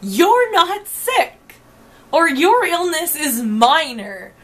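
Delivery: high, loud, slow dismissive mimicry; sneering imitation of the staff; mocking staff. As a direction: mimic the staff brushing her off. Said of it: "this staff of people is".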